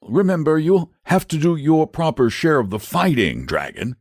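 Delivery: high knight voice, admonishing; Saint George, firm. A narrator's reading. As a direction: remind the dragon of its duty. George reminds the dragon.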